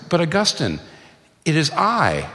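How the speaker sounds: high, coaxing call after him; mistress calling out. Retold or imitated. Old mistress calling to departing Augustine, coaxing. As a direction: call him back sweetly, a touch pleading.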